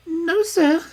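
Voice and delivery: put-on high, loud servant girl voice; a nervous young maid insisting; frightened maid. A narrator's reading. Maid answering Holmes, high and loud.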